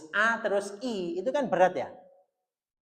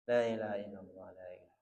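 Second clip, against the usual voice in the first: La ilaha illallah dilafalkan lirih dan rendah; light soft recitation; 12 semitones lower, 8 dB quieter, faster than usual. Shown as a demonstration. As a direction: lafalkan La ilaha illallah pelan dengan bibir terbuka. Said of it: "Nah, kayak gitu tuh". (La ilaha illallah dibaca lirih)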